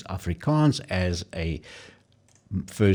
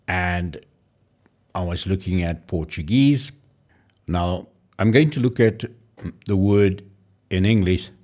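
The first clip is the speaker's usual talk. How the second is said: ordinary talk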